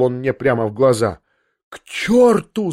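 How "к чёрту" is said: slow, much higher shouted curse; drawn-out yell at the prince. A narrator's reading. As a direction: fling it out at the top of the voice. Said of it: "завопил я".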